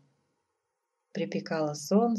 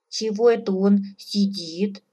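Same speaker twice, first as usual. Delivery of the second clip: mildly bewildered moose cow, louder; puzzled; 3 semitones higher, 7 dB louder than usual. A narrator's reading. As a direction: ask it loudly in mild puzzlement. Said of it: "с недоумением спросила лосиха". (с лёгким недоумением)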